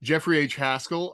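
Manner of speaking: usual speaking voice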